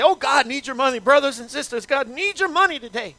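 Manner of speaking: high, loud Southern preacher pleading for money; TV evangelist southern accent. Retold or imitated. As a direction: ham up a Southern televangelist begging for cash. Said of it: "why do they always have a southern accent".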